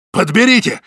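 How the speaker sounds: man yelling at a startled woman to pick up food; terrible yell. A narrator's reading. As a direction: yell it at her. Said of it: "страшно заорал он".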